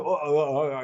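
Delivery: retold nervous stammer on "uh"; halting, tongue-tied filler sounds; stuttering. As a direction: stumble over "uh" like a caught player. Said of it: "I'm stuttering".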